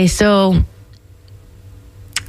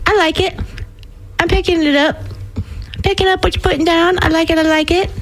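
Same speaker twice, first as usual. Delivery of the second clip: higher, bubbly pleased voice; light up with delight at the nickname; 8 semitones higher, much faster than usual. Real, not acted. DJ thrilled by a listener's nickname, higher and delighted.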